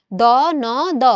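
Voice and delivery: দ, ন, দ one sound at a time. Shown as a demonstration. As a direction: spell out the sounds দ, ন, দ with gaps. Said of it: "এটা উচ্চারণ হচ্ছে".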